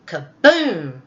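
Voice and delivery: a booming crash word; loud kaboom. Acted. Elephant topples over, a loud kaboom. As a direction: call it out like a big crash.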